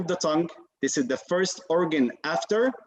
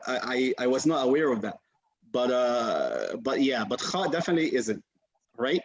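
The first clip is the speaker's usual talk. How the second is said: usual speaking voice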